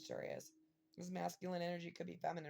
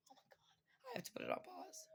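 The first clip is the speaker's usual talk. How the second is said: breathy hushed whisper, higher; whispered aside; 13 semitones higher, 6 dB quieter than usual. Real, not acted. Reader, overcome, whispers that she must pause the video. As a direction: whisper it, overwhelmed, about to stop filming. Overwhelmed, stops the recording.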